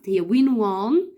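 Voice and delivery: teacher stressing the past tense; slow contrast. Shown as a demonstration. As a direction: win then won, slow and stressed. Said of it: "The word one اللي هو verb".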